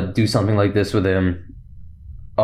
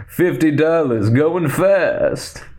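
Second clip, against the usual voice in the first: higher mock sales pitch; Lando; 4 semitones higher, much slower than usual. Lando selling carts on Snapchat, mock sales pitch. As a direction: playfully hawk it in a put-on voice. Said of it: "He goes".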